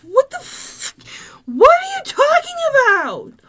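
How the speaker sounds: high, loud alarmed questioning; startled mom. Retold or imitated. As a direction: imitate a startled mom jolted awake. Mom startled by child at night.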